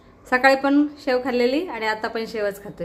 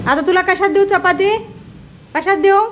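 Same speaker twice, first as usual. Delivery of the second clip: exasperated question; 7 semitones higher, 6 dB louder than usual. Real, not acted. Asking crow where to serve.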